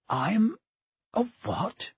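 put-on high, halting boy's gasp; pause, then gasp the question; gasped disbelief. A narrator's reading. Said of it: "gasped Harry".

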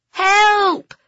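high, loud cry for help; shouted. A narrator's reading. Children trapped in a van call for rescue, shouted. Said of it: "they shouted".

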